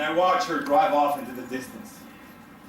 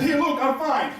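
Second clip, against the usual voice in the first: reassuring; 8 semitones higher than usual. Retold or imitated. Reassuring the driver after crash.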